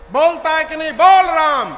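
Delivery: angry demand. Acted. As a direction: lose patience and yell.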